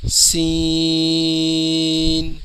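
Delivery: سین chanted slowly, long ee into n; final n sounded. Shown as a demonstration. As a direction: stretch سین evenly, close on n. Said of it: "اس کے اینڈ میں نون کی آواز نکلے گی".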